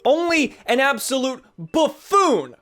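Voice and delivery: loud, high, outraged tirade; mock berating. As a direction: tear into them in mock disgust. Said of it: "Can you verbally berate me".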